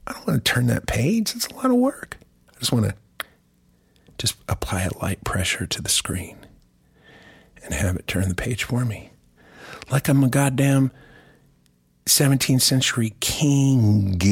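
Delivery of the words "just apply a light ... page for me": soft breathy whisper. Acted. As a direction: whisper it lazily. Lazy light pressure on screen.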